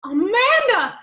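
loud, high shout of her name; spotting the old rival, a loud high shocked cry. Acted. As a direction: yell it in outraged recognition. Enemy bursts in.

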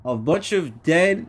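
ordinary talk, nothing marked

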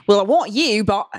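put-on shaky stab at an Aussie accent; Australian accent attempt. Podcaster mimics an Aussie commenter, bad Australian accent. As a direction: try Crocodile Dundee's Australian accent. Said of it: "Australian. Okay, Crocodile Dundee".

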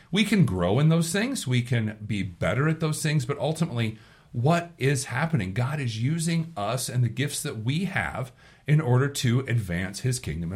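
normal talking voice

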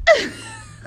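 squealing cracked laugh; high cracked laugh. Real, not acted. Laughing at losing voice.

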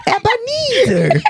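high, slow cartoon villain drawl. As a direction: stretch the name like a cartoon baddie. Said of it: "he sound like a villain for a cartoon".

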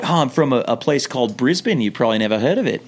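put-on Australian customer voice; Australian accent. Customer pretending to come from Brisbane, put-on Australian accent. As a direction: bluff casually in an Australian accent. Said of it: "speak the whole time with an Australian accent".

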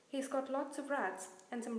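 lightly muffled character voice; a hint of muffled, choked-up speech. A narrator's reading. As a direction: read it as if slightly muffled. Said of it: "said Hagrid in a muffled voice".

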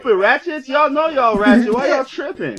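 high, loud scolding; hammed-up guys yelling at the girls; mock angry yell.

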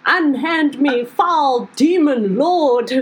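put-on pompous drunk, stretched-out words; posh drunken goblin. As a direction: declaim like an outraged tipsy aristocrat. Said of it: "I would not sound so posh".

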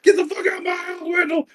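high, fast shouted eviction; imitated furious shout. Grandfather swinging the belt at Wendell, mock furious shout. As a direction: roar it like an enraged grandfather.

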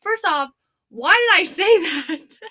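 slow words broken by laughter; laughing through words. Retold or imitated. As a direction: crack up mid-sentence at your own line. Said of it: "I start laughing so hard".

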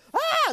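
sudden high anguished yell; pained cry. Retold or imitated. Man trapped under a car cries out in pain.

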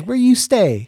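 high, loud curious question; imitating the girl. Girl asking where he lives, imitated high. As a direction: pitch her question way up. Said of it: "And she goes".

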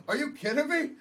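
high, incredulous question; disbelief. Retold or imitated. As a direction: pitch it up in disbelief. Retold disbelief at girlfriend.

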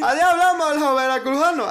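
high, loud sneering impression of people; mocking imitation. Annoyed at people who claim Veracruz speech, high loud mockery. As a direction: parrot them mockingly. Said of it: "Yo estoy enojado de las personas que dicen".

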